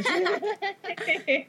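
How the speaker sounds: Southern drawl breaking into a laugh; playful Southern drawl. Pretend. Guest offering her accent to the hosts, joking Southern drawl. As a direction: lay on a little Southern drawl, amused. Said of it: "add a little Southern drawl".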